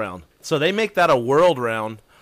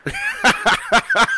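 high burst of laughter. Real, not acted. Laughs at the joke.

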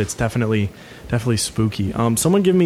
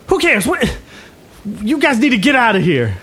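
high, put-on thug voice; high-pitched, irritated young tough; flustered gang leader; 9 semitones higher than usual. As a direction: try to keep up the threat while thrown off. Game master plays confused gang leader.

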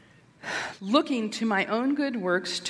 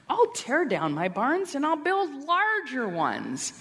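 boastful rich man; 7 semitones higher than usual. Acted. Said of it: "the rich man from Luke twelve who says".

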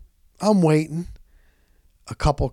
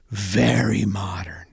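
awed whisper; 5 semitones lower, slower than usual. Real, not acted. Impressed entering the apartment.

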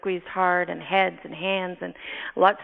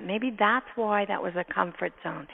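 ordinary delivery

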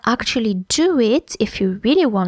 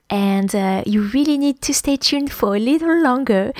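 normal talking voice

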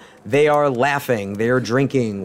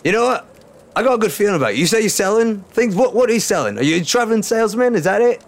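eager and friendly; 8 semitones higher, faster than usual. Acted. Bandit leader suddenly warms up.

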